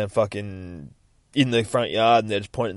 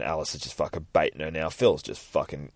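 normal talking voice

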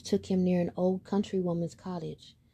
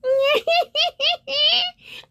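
shrill mocking cat laugh, much higher; high cat cackle; 8 dB louder than usual. Cat cackling at the scared duckling, high and loud.

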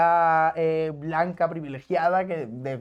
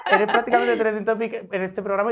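laughing through the words, a little higher and louder; laughing while teasing; 6 semitones higher, 4 dB louder than usual. Real, not acted. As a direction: tease her while you're still laughing.

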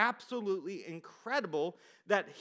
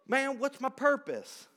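put-on higher, louder Southern-accented quote; 6 semitones higher, 5 dB louder than usual. Preacher mimicking people who ask their purpose, in a Southern accent. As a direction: lean into the Southern accent, loud and high. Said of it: "I go to a Southern accent".

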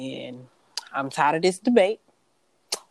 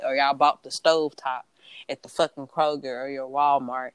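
usual speaking voice

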